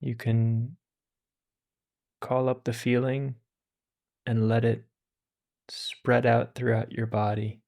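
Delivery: calm, unhurried meditation voice with long pauses; guided meditation. Acted. Teacher guiding listeners through meditation, calm and unhurried. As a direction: ease listeners into stillness.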